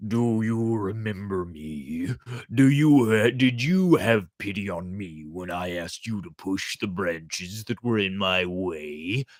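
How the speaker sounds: calm, lightly voiced reproach; water reminding the chick of his refusal; reproachful water. A narrator's reading. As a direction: remind him coolly. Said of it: "Water asked".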